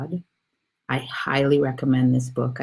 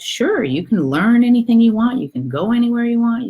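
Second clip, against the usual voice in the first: high, loud, generous "go right ahead" voice; storyteller's God granting permission, pitched well up; encouraging God; 9 semitones higher, 7 dB louder than usual. Acted. God answers the little soul's request, high and emphatic. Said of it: "talked to God".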